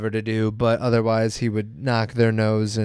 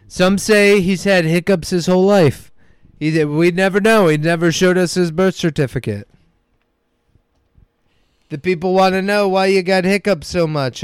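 political rant impression; 5 semitones higher than usual. Political impression mocking hiccups.

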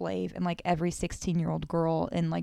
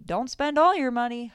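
higher, louder call out of the van; retold driver call; 5 semitones higher, 6 dB louder than usual. Driver shouting to the drunk man, mock call-out. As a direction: call after him like the driver did. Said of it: "I hear the guy who dropped him off say".

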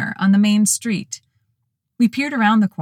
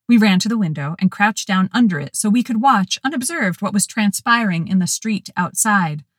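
ordinary delivery

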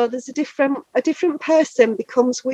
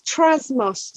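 Trasmos drawn out slowly; slow, careful name. Shown as a demonstration. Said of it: "so T R A S M O Z".

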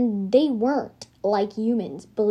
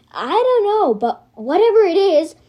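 high, loud, tense answer; tense raised voice; 8 semitones higher, 7 dB louder than usual. A narrator's reading. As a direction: blurt it out nervously.

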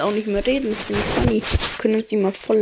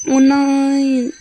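higher, louder mock panicked oh no; 4 semitones higher, 6 dB louder than usual. Hero facing the slime alone, mock panic.

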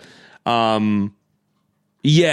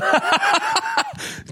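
high laugh. Real, not acted. Laughing at own joke.

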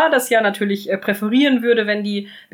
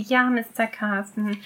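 softer, slow, timid maid's reply; a hint of a timid kitchen maid; meek answer; 6 dB quieter than usual. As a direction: shrink before the butler.

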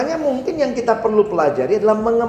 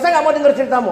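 a light, higher mock refusal with a laugh; refusing friend; 7 semitones higher, much faster than usual. Preacher voicing a friend who refuses to listen. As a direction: brush the story off, laughing. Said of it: "Kalau dia bilang".

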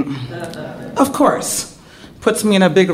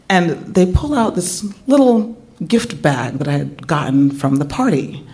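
ordinary talk, nothing marked

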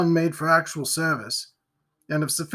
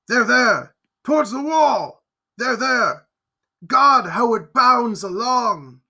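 loud, higher shout of alarm; frantic shouting; 6 semitones higher, 5 dB louder, slower than usual. A narrator's reading. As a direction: yell to point the creature out. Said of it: "as he cried".

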